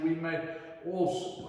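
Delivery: plain reading voice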